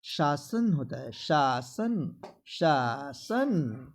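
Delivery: शासन as "shaa-san", increasingly drawn out; sh first, s second in शासन; correct pronunciation. Shown as a demonstration. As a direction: slowly split शासन into two syllables. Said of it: "पहला तालव्य स और दूसरा दंत स".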